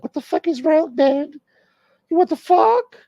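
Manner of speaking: hammed-up girlfriend nagging in falsetto; wife's voice. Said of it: "she's gotta whisper in your ear".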